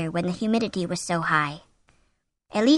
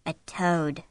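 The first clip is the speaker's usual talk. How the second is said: dazed, softer reply; stupefied; 3 semitones lower, 5 dB quieter than usual. A narrator's reading. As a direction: say it stupidly, stunned. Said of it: "he said stupidly".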